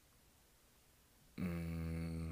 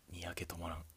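ordinary talk

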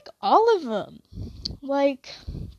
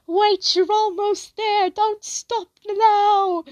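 high, slow, coaxing mock cheer; mock encouraging voice; 9 semitones higher, slower than usual. Someone in her shifting script urging her on, in a high, slow coaxing voice. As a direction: egg them on, drawn out. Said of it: "someone will go".

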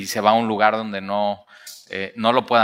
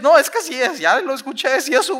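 high, pushy insistence; girl insisting she heard him; 12 semitones higher than usual. Acted. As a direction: argue it much higher and eager. Rode argues with the disciples.

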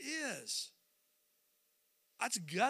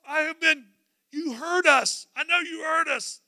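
raised, loud protest; indignant complaint; 6 semitones higher, 7 dB louder than usual. Acted. Blind men confronting Jesus at the table, indignant. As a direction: confront him for ignoring you.

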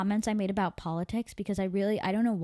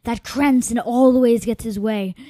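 angry; 3 semitones higher, 11 dB louder than usual. Acted. Angry soldier ranting.

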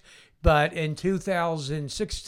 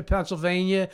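usual speaking voice

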